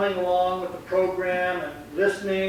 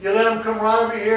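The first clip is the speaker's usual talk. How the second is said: usual speaking voice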